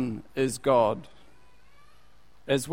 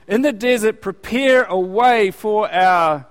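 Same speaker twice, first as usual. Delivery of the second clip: loud, slow, higher desert cry; proclaiming prophet; 5 semitones higher, 7 dB louder than usual. Acted. Quoting Isaiah's cry again.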